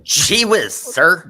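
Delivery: put-on loud, high gee-whiz kid voice; wholesome 1950s kid. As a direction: play it like an eager boy from an old TV show. Folksy parody.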